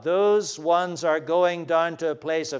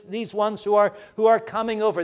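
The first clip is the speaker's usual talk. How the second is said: normal talking voice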